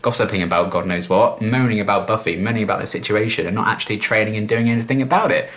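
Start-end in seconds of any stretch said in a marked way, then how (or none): none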